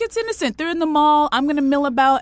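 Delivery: airy carefree mom. Retold or imitated. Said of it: "she was like".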